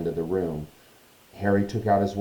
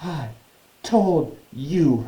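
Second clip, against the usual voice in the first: higher, broken up by catches of breath; gasping; 7 semitones higher, much slower than usual. Acted. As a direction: gasp it out between words. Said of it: "Hermione gasped".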